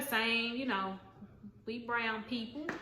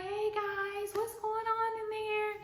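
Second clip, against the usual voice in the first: very high, singsong upbeat hall leader; perky hall leader; 11 semitones higher, slower than usual. Retold or imitated. Hall leader knocking on the dorm door, very high singsong voice. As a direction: chirp it like a cheerful upperclassman. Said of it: "She's like".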